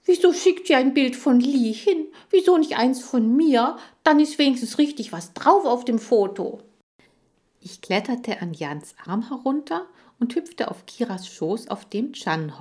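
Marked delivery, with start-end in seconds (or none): 0.0-6.6 high, loud huffy protest; indignant, wounded-pride hamster outburst; offended. A narrator's reading. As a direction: complain like a slighted rival. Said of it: "Chan war beleidigt".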